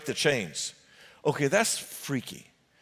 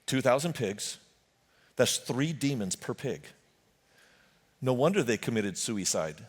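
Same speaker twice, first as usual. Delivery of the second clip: ordinary talk, nothing marked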